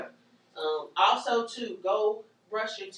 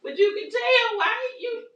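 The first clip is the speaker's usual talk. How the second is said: excited insistence; 7 dB louder than usual. Real, not acted. Emphatic point to audience.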